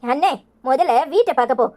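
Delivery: normal talking voice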